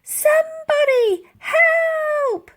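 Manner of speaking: very high, slow, loud scream; scream for help. Acted. Elsie screaming for help across the ice. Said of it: "screamed Elsie".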